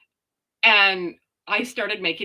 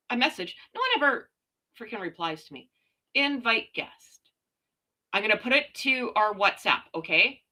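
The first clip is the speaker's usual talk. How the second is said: ordinary talk, nothing marked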